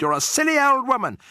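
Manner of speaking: high, loud, shrill snob; shrill haughty lady. A narrator's reading. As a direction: shriek it at her. Mrs Jenkins insulting.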